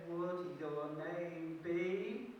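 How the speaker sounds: very slow, drawn-out question; mother teasing the fairy before naming her, very slow. Acted. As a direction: stretch it to build suspense. Mother drawing out the answer.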